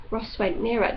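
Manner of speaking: usual speaking voice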